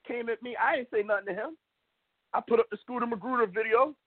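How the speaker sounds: usual speaking voice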